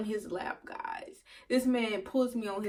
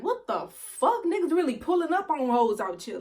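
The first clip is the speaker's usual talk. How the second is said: shook disbelief; 4 semitones higher than usual. Retold or imitated. Clubgoer shook by boyfriend turning up, astonished. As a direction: stretch out the shocked question, pitched high. Explaining she was shook.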